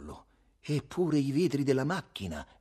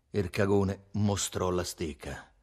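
usual speaking voice

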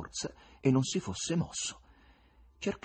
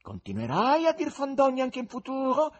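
menacing question; 6 dB louder than usual. A narrator's reading. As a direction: threaten the head. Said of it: "chiese minaccioso".

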